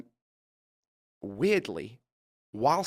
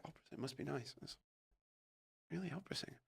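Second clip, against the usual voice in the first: sarcastic aside; 18 dB quieter, faster than usual. Retold or imitated. Muttered sarcastic reply.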